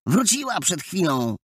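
put-on high, excited boys calling out; clamouring calls. A narrator's reading. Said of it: "jeden przez drugiego wołali".

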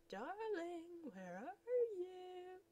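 high, slow crooning call; sing-song searching call; lovesick calling. Acted. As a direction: croon it into the empty house. Suitor calling through house.

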